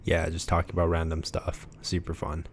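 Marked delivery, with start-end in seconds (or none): 1.8-2.5 flat, deadpan super fun; monotone. Real, not acted. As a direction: say it with zero enthusiasm. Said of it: "You said that in such, like, a monotone way".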